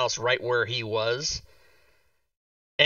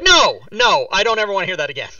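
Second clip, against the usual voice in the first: loud, raised-pitch objection; mock protest; 5 semitones higher, 8 dB louder than usual. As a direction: protest as if lightly scandalized. Acting out his shocked protest.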